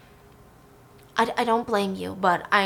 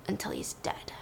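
hushed whisper; 10 semitones lower, 15 dB quieter than usual. Real, not acted. Dramatic end of a fight.

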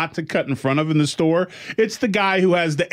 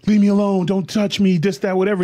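higher-pitched mock yell, not full volume; retold scream; 6 semitones higher than usual. Woman yelling at the man behind her, mock screaming. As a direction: imitate a woman screaming, held back. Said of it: "Screaming her at the top of her lungs".

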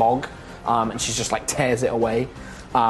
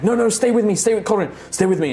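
pleading healer; 8 semitones higher, faster than usual. Acted. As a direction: beg him to hold on. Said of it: "is like".

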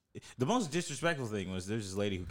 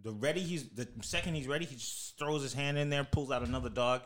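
ordinary talk, nothing marked

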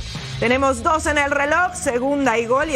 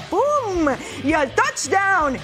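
excited call; 3 semitones higher, much slower than usual. Real, not acted. Touchdown throw call.

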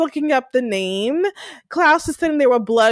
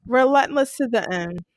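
normal talking voice

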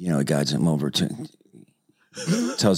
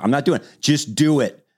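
higher, insistent back-and-forth; high mock argument; 10 semitones higher, 4 dB louder than usual. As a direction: argue it out in a raised voice. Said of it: "He goes".

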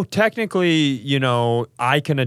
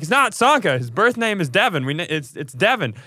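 exasperated insisting; 6 semitones higher, 3 dB louder than usual. Retold or imitated. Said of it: "I'm like".

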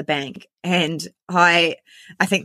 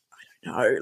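faint, low, whispered answer; mouthed reply; 7 semitones lower, 5 dB quieter than usual. Retold or imitated. Customer secretly answering the receptionist, soft and nearly soundless. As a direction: imitate mouthing it back secretly. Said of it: "she literally mouthed to me".

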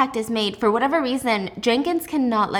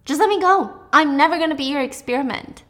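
defiant; 4 semitones higher than usual. A narrator's reading. Juliet defying Warner.

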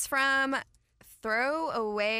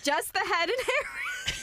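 words through laughter; giggling out the line; laughing; slower than usual. Real, not acted. Laughing while reading the punchline.

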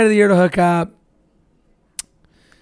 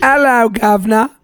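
mock-Cockney greeting, pitched up; British accent; 4 semitones higher than usual. Comic guessing how British kids sound, in a mock British accent. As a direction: put on a cartoonish British accent. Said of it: "Is that what it sounds like?".

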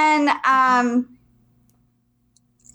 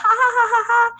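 high-pitched spoken "ha ha ha"; mock laugh; 11 semitones higher than usual. Storyteller imitating a harmless laugh, high mock ha-has. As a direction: imitate a light laugh on one raised pitch. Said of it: "it wasn't like a".

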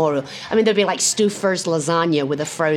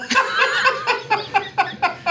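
high laugh. Real, not acted. Laughing at the heckle.

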